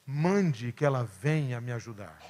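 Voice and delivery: firm, soft-spoken command, not a request; almost an order. Retold or imitated. Martha asking Jesus to send Maria, a mild order. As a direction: tell him to send her sister, lightly insistent. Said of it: "quase em tom de ordem".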